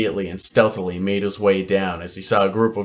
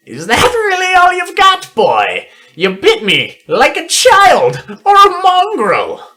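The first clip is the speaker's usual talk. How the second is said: put-on high, loud sneering old villain; mock him like a cruel necromancer; 6 dB louder than usual. Necromancer mocks Sycamore.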